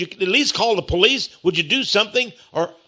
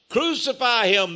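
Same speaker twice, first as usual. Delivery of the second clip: crowd crying out; 7 semitones higher than usual. Acted. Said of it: "they cried out".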